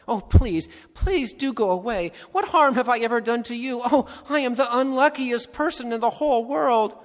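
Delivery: frightened Shasta begging the unseen creature to leave; frightened pleading. A narrator's reading. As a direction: beg it to go away in fear. Frightened boy begs the creature.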